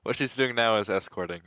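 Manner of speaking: usual speaking voice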